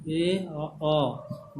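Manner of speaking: ए and अ held long, one after another; two vowel sounds. Shown as a demonstration. Teacher proving there are two sounds, vowels sounded out separately. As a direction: separate ए from अ, lingering on each. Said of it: "दो sound आ रहा है".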